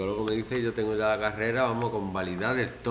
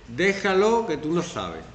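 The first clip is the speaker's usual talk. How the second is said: dismissive brush-off; 6 semitones higher, 5 dB louder, slower than usual. Retold or imitated. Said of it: "Este, este".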